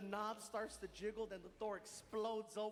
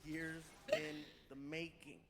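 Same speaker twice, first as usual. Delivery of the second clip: announcer intro; 6 semitones lower than usual. Acted. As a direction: present the big reveal to the crowd.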